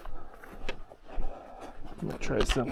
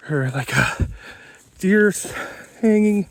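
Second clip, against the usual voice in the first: out of breath; 5 semitones higher, 5 dB louder, slower than usual. Real, not acted. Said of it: "Sorry, I'm out of breath".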